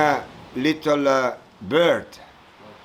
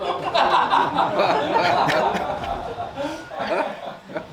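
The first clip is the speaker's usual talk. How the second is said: high breathy burst of laughter; real laugh; 14 semitones higher than usual. Real, not acted. Monk laughing at the cow dung in his story, breathy laugh.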